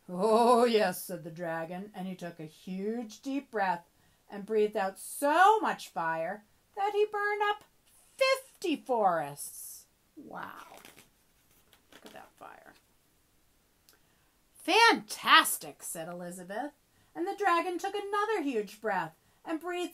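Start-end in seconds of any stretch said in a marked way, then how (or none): 0.1-1.0 loud, drawn-out dragon boast; big booming agreement; boastful dragon. Acted. 10.2-12.7 hushed, amazed whisper; very soft whispering; whispered aside. Real, not acted. Whispered aside about picture. 14.5-15.8 loud, high, drawn-out praise; big stretched-out exclamation; enthusiastic princess. Acted. Elizabeth cheers the dragon on.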